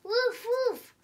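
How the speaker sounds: high, loud barked woof. Acted. The puppy arrives, loud barking woof. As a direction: bark it out like a big dog.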